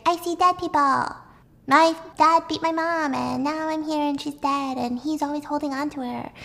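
put-on high-pitched, eerie six-year-old; creepy little girl. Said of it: "creepy little six-year-old girl's voice".

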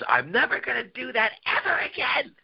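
high, put-on little girl voice; imitating little Franny. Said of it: "she says".

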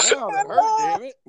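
loud, very high yelp of protest; mock pained outburst. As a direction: yell like you just got slapped. Acting John's outburst after the slap.

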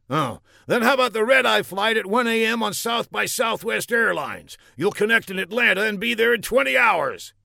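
high general voice. Acted. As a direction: offer it like the general. General offers flight.

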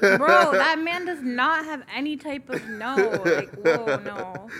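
loud, laughing protest; laughing denial. Real, not acted. Denying she slept with Young Mike, laughing loudly.

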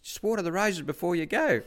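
a high, chirpy put-on trainer's voice; mock-cheerful boss dropping by; imitating Bart, breezy. Bart insists, casual.

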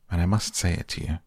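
usual speaking voice

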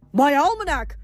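overjoyed at the gift; delighted cry. Acted. Farm god thrilled to see his lost almanac, high and loud.

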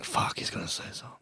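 hushed, quick dread-filled whisper; retold frightened whisper under the breath; scared inner thought. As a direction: whisper it fast, as a private panic. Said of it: "I'm, I'm scared".

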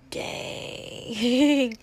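long drawn-out falling dang; stunned. Retold or imitated. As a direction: let dang sink slowly, amazed. Stunned reaction to drama.

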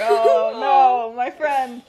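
loud, badly faked dismay; fake sadness. Remus remembering he must look sad, badly faked sorrow. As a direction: ham up a sorrowful "oh". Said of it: "I'm supposed to be sad".

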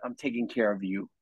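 low, soft reassurance; tender God. Retold or imitated. God speaking to the listener, low soft reassuring voice. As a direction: comfort a child gently. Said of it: "he's like".

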